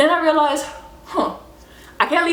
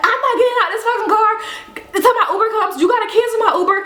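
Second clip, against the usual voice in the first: mock shrill yell; 11 semitones higher, faster than usual. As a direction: squeal it like a stubborn passenger. Passenger yelling refusal, quoted.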